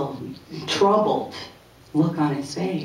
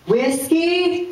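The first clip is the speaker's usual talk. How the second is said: put-on much higher, innocent little-kid question. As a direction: chirp it out like a guileless six-year-old. First grader answering dentist.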